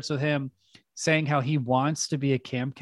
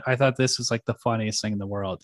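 ordinary talk

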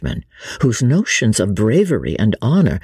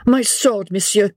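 put-on high-pitched French-accented retort; 10 semitones higher than usual. A narrator's reading. Vicomte replies with his sword, French accent. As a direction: answer proudly as a young Frenchman. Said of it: "replied the Vicomte".

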